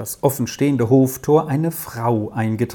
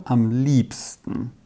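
slow, low; slower than usual. A narrator's reading. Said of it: "äußerte sie lebhaft".

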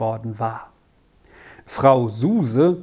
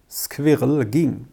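plain reading voice

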